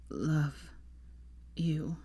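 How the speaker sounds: fading man's hushed voice; weak, failing voice. A narrator's reading. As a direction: murmur it haltingly, short of breath. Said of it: "his quick, shallow breaths".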